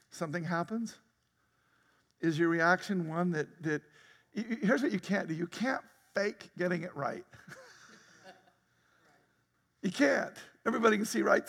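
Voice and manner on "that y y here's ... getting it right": ordinary talk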